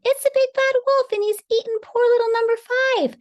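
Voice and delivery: very high, loud shrieking duck; in a rage. A narrator's reading. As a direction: shriek the accusation in outrage. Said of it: "flew into a rage".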